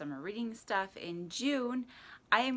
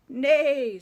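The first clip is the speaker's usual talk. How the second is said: loud, high falling neigh; horse neigh; 7 semitones higher, 12 dB louder than usual. A narrator's reading. Horse greeting the truck, loud neigh. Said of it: "said a horse".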